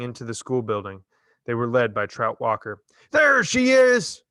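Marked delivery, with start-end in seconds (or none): 3.1-4.2 high, loud accusing shout. Acted. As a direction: point her out to the mob at full cry. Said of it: "Trout shouted".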